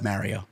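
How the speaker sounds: MARIO with the "a" of marry, soft and low; low, quiet MARE-ee-oh; habitual pronunciation of Mario. Shown as a demonstration. Saying it as all my life, low MARE-io. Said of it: "I've said it all my life".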